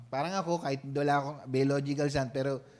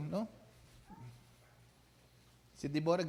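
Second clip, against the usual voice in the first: usual speaking voice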